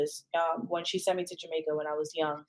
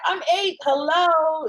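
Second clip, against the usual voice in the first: much higher, louder disbelieving retort; incredulous retort; 10 semitones higher, 6 dB louder, slower than usual. Retold or imitated. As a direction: throw back the obvious, outraged. Child retorting to the predator.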